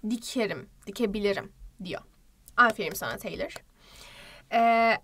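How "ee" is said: usual speaking voice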